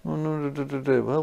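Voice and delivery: plain reading voice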